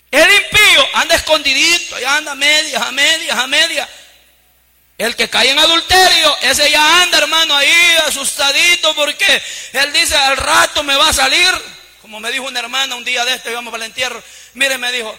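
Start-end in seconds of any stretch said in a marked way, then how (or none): 0.1-11.5 loud, rising sermon cries to the congregation; full-force preaching. Acted. Pastor preaching to his flock, loud and fervent. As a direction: preach it hard, voice ringing out.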